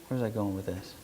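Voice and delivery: low, very soft self-directed aside; talking to oneself. Real, not acted. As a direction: murmur to yourself, having lost your place. Lost place, talks to self.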